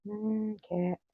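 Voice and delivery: high soft call to the cat; coaxing cat. Real, not acted. Coaxing the cat to come, high and soft. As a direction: sweet-talk the cat over.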